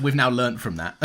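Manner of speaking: ordinary talk, nothing marked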